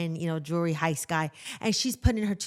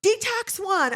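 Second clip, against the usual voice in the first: much higher, strained, hammed-up officer barking orders; mock cop yell; 6 dB louder, slower than usual. Imitating a jail officer shutting down a chatty inmate, hammed-up yell. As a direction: play a fed-up jail cop snapping at the inmate. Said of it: "he'd be like".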